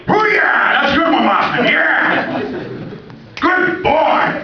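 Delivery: high, loud excited shouting praise; hammed-up Pacino praising the driver; Al Pacino impression. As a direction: cheer him on like a manic Pacino. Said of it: "Be like Al Pacino".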